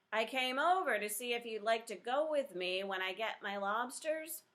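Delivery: put-on captain speaking up over a howling boy. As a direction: raise the voice a touch over the noise. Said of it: "went on a little louder".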